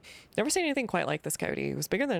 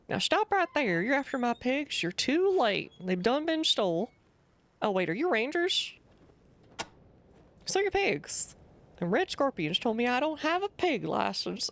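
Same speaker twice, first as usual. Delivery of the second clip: put-on grumpy old farmer; 7 semitones higher, slower than usual. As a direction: warn strangers off your land. Voices the farmer NPC.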